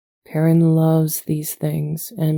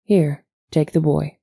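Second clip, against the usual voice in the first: ordinary talk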